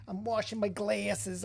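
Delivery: slow, hammed-up [name] accent; bad [name] accent. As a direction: do a terrible [name] prank-caller accent. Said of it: "my terrible [name] accent".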